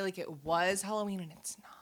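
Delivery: whispering. Real, not acted. Whispered aside about Halloween.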